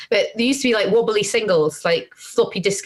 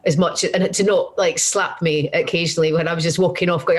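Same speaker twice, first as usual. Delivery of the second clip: ordinary delivery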